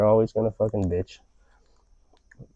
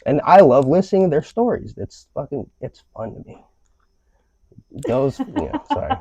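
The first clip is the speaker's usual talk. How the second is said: ordinary delivery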